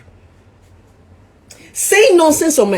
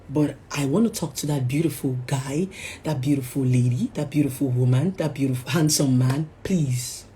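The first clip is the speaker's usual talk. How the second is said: softer, lower, caring advice; gentle reassurance; 6 semitones lower, 7 dB quieter than usual. Real, not acted. Vlogger softly reassuring viewers who made mistakes. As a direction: talk them gently away from despair. Soft encouraging talk to viewers.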